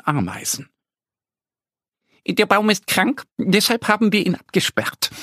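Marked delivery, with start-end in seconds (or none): 2.3-5.1 higher, eager little ant voice; eager ants explaining. Acted. The ant police explain to Annie and Mo, eager and higher. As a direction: rattle it off like busy ant police. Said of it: "erklären sie eifrig".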